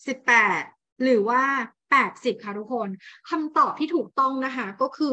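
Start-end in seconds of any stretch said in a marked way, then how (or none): 0.1-2.3 สิบแปด and แปดสิบ said louder, one by one, with a pause after each; each number said apart. Shown as a demonstration. Teacher quizzing her class, each number said loud and apart. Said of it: "เอานะ".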